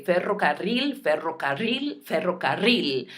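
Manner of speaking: "ferrocarril" recited three times running, R's rolled; drill-like repeats of "ferrocarril", trilled rr; three times in a row. Shown as a demonstration. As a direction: reel off "ferrocarril" thrice with Spanish trilled R's. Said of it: "say this word three times in a row".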